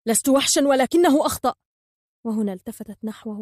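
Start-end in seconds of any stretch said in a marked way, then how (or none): 0.1-1.5 loud, raised, heated retort; angry outburst; sharp and angry. A narrator's reading. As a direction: defend yourself furiously. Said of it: "قال عاصف بحدة وغضب".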